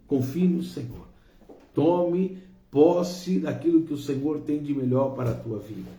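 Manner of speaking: ordinary talk, nothing marked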